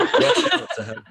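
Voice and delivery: high, slow laughing squeal. Real, not acted. Bandmate laughing at the slogan pun, squealing.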